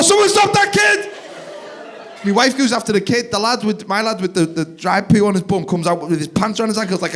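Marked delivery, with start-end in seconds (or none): 0.0-1.1 very high, loud panicked call; alarmed shout. Acted. Dad as the kid runs off, alarmed shout. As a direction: yell across a room in mock panic. Calling out across dance floor.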